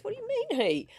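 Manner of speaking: high, baffled mock dad voice; surprised dad. Daughter imitating her dad, high and baffled. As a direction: squeak it up like a confused father. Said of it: "and he goes".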